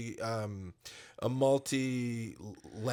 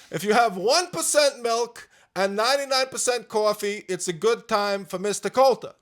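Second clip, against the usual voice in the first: Bernie Sanders impression; 7 semitones higher, 7 dB louder than usual. Host asked for celebrity impressions, higher, louder Bernie lecture. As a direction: hammer the percentages like a stump speech. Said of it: "Bernie Sanders".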